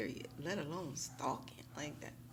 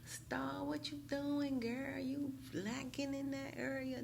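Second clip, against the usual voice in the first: high-pitched teasing challenge; mock viewer call-out; 10 semitones higher, slower than usual. Viewers question Star about slipping, high mock call-out. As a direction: call yourself out in a high imitated voice. Voicing viewers calling her out.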